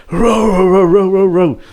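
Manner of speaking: high barking row row; imitated woofs, high and loud; mock dog barks. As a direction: bark it out like a huge dog.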